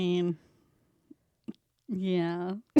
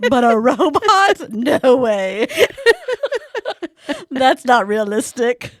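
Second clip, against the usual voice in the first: loud laughing scoff; 4 semitones higher, 10 dB louder, much slower than usual. Real, not acted. Mock outrage, laughing, loud.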